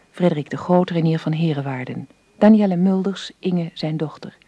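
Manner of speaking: ordinary talk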